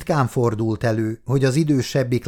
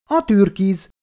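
put-on high, louder child's voice, instant answer; eight-year-old, unhesitating; 10 semitones higher, 5 dB louder than usual. A narrator's reading. Eight-year-old answering a favourite-colour question, high and prompt. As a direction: fire back the answer in a child's high pitch. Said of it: "mondta a nyolc éves habozás nélkül".

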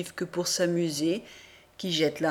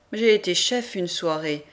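usual speaking voice

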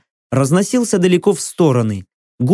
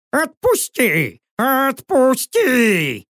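high, long-drawn wailing plea; desperate high-pitched owl wail; pleading owl; 8 semitones higher, much slower than usual. A narrator's reading. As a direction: beg for release, stretching each cry further. Captured owl begs to be released.

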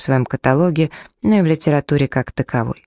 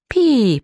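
high beep sound, mock voicemail tone; beep imitation; 10 semitones higher than usual. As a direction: chirp it out like a machine beep. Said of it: "повторила Амелия".

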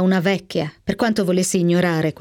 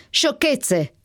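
shout; 7 semitones higher than usual. A narrator's reading. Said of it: "urlò".

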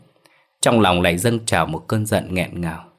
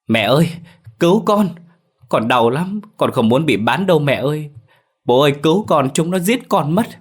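much higher, panicked cries of pain; screaming for help; 8 semitones higher than usual. A narrator's reading. Beaten son cries to his parents for rescue, screaming. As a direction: plead desperately, voice pitched right up. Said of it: "tiếng Đạt gào thét vang vọng".

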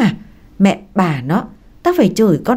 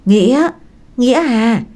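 raised, higher voice calling a name; booming call; 4 semitones higher than usual. A narrator's reading. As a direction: call a son out from inside the house. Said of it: "giọng oang oang kêu lớn".

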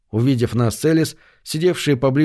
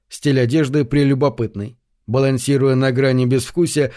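plain reading voice

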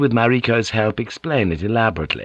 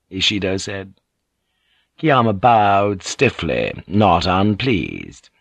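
ordinary delivery

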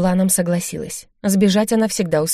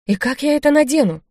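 mildly indignant higher-pitched question; 7 semitones higher than usual. A narrator's reading. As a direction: ask it in raised-pitch protest. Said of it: "возмутилась она".

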